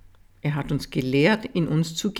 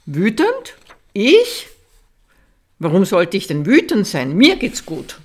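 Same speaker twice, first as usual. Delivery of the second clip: indignant denial; 3 semitones higher, 5 dB louder than usual. Pretend. Mock denial of anger.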